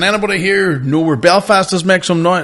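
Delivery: high-pitched stage call to the room; hyping a crowd. Retold or imitated. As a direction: work up the crowd, retold. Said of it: "trying to get the energy going".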